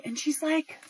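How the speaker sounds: ordinary talk, nothing marked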